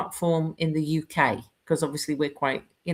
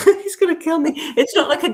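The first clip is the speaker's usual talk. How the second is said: laughing; 10 semitones higher, 5 dB louder than usual. Real, not acted. Laughing at own remark.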